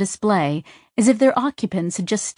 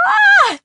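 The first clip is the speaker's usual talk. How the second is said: high, loud delighted laughing shriek; burst of laughter; 8 dB louder than usual. Acted. Sidra bursting out laughing after fooling the merchant. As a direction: let out a gleeful laughing cry. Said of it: "burst into laughter".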